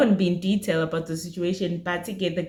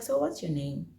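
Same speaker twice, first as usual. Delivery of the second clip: soft question; 3 semitones lower, 8 dB quieter than usual. Retold or imitated. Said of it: "Very soft".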